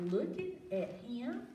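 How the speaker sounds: plain reading voice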